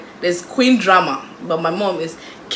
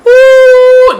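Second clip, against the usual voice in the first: very high, loud gossipy ooh; loud gossip ooh; 16 dB louder than usual. Retold or imitated. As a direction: shriek a juicy-gossip ooh. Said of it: "they go".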